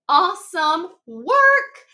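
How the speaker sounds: high, loud and drawn out; excited praise. Real, not acted. Teacher praising students for hard work, excited.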